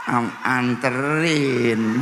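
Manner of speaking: slow, coy, drawn-out "anterin" with a giggle; a wife playing shy and pampered, stretching "anterin". Wife answering her husband, slow and coy. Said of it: "Sambil nyaut".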